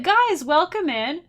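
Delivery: high, loud, slow hammed-up welcome; mock streamer greeting. Pretend first-day stream greeting to chat, hammed-up and high. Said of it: "I'll be like".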